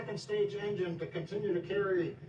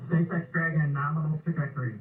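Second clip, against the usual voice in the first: breaking up space radio; 7 dB louder than usual. Real, not acted. Mission radio callout during launch.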